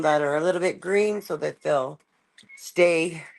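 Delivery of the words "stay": ordinary talk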